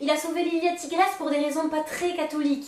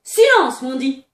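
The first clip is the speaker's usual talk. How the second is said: sharp shout; 9 dB louder, slower than usual. Retold or imitated. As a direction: snap the order. Imitating Peter's order. (ordre crié)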